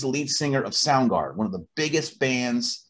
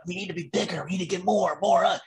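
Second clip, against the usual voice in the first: higher-pitched mock urgency; mock-needy striving; 6 semitones higher than usual. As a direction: mimic someone pushing for more. Mimics needy artists' mindset.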